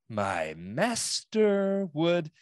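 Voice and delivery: slow, drawn-out cold hissing lizard voice; lizard man voice. Retold or imitated. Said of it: "the lizard man voice there".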